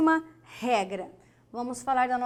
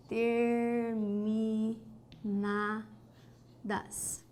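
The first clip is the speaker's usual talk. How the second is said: "Terminadas" very slow and soft, syllables stretched; 2 semitones lower, 6 dB quieter, much slower than usual. Shown as a demonstration. Teacher writing a word down, "Terminadas" syllable by syllable. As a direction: softly draw out each syllable of "Terminadas". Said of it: "Vamos colocar aqui".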